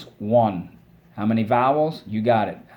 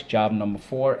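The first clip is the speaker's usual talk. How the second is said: usual speaking voice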